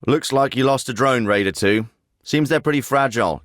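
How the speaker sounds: usual speaking voice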